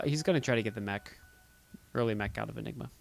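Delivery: usual speaking voice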